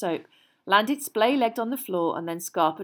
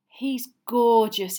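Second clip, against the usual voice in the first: slow, louder delight; glowing; 4 semitones higher, 8 dB louder, much slower than usual. Acted. Hermione delighted with her cat, slow and glowing. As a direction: gush over the cat.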